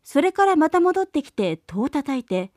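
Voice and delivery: plain reading voice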